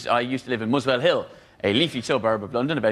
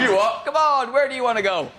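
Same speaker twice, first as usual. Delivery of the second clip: much higher, louder, laughing London cabbie; mock indignant cabbie; 10 semitones higher, 3 dB louder than usual. London cabbie to passenger, mock indignation. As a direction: bark back like an affronted cabbie.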